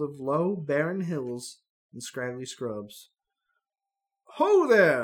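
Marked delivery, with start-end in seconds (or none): 4.3-5.0 loud hail from afar; calling out. Acted. Old man hailing the travelers, loud call. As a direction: hail strangers across the hills. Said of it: "a voice calls".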